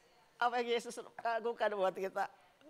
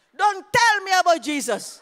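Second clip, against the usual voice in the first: loud, slow, higher angry retort; angry foreigner; 8 semitones higher, 15 dB louder, slower than usual. Retold or imitated. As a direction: bark it like an offended stranger. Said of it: "Marah dia sama saya".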